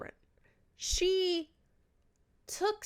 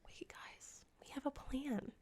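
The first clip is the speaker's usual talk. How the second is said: hushed unvoiced whisper, softer; 4 semitones higher, 14 dB quieter than usual. Rhysand tells the gang the plan, whispered. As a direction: whisper it like a secret. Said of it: "And Rhysand's like".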